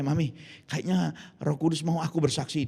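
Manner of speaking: usual speaking voice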